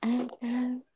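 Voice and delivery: very soft whisper; hushed, breath-only murmur; ASMR whisper. Pretend. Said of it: "A S M R, Opal?".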